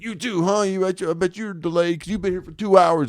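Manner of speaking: high, lightly voiced grumpy old man; hint of a complaining stranger; ranting old man. Stranded old man grumbles to fellow traveler.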